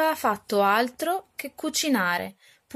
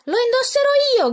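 put-on high, loud, fast shriek of a spoilt girl; shrieking stepsister; 6 dB louder, faster than usual. Araminta screams at her sister over the shawl, high and shrill. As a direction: yank the shawl and screech it out. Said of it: "gridava Araminta".

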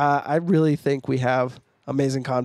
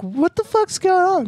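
put-on high, loud baffled girl voice; shrill confused woman; 5 dB louder than usual. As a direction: squeal it in alarm. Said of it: "She's like".

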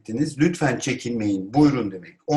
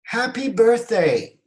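loud, high, drawn-out cheer; exclaimed greeting; 10 semitones higher, 5 dB louder, slower than usual. Shown as a demonstration. Teacher models congratulating someone's birthday, exclaimed. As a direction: cry out slowly in celebration. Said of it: "haykırarak söylüyorsunuz".

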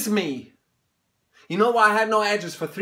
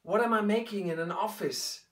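softer, inward questioning; quiet; 5 semitones lower, 9 dB quieter than usual. Acted. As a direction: ask yourself in a hush. Self-questioning, hushed.

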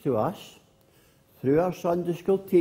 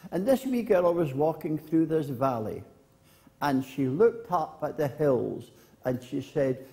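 usual speaking voice

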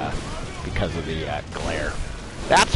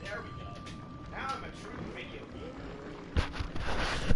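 far from mic; 10 semitones higher, 15 dB quieter than usual. Real, not acted. Speaking from across the room.

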